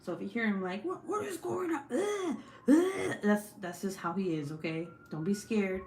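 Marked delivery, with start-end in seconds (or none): 0.8-3.2 high, exasperated mock yelling; mock angry husband. Wife imitating husband fed up with kids, mock angry. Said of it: "angry because the kids are doing stupid shit".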